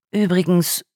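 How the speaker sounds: ordinary delivery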